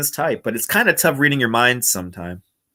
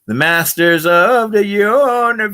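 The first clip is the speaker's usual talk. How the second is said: high, loud, slow title cry; grand mock proclamation; 9 semitones higher, 5 dB louder, slower than usual. Streamer reacting to a cartoon overlay, loud drawn-out proclamation.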